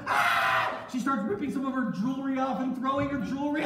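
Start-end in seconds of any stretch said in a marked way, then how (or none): 0.1-0.9 long, loud staged scream; mock scream. Wife screams while Joseph looks on, staged scream. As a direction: scream it out and hold it. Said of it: "And then she screamed".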